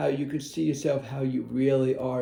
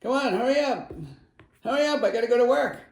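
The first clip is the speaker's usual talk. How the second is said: higher, fast, impatient mock rushing; hammed-up stressed driver late for work; 8 semitones higher, faster than usual. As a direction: rush the car ahead, mock-frantic. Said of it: "and it's like".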